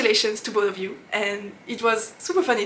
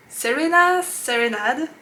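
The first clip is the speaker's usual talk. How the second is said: "Serena Serenade" in a heavy French accent; name read with strong French vowels; 4 semitones higher, 5 dB louder, slower than usual. Real, not acted. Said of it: "I said that with a heavy French accent".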